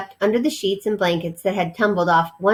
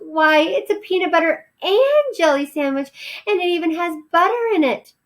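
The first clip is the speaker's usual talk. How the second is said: amazed mother mouse; 10 semitones higher than usual. Acted. Mother still marveling.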